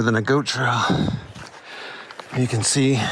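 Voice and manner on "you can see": panting while hiking uphill; breathless from the steep climb; out of breath. Real, not acted. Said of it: "I'm out of breath".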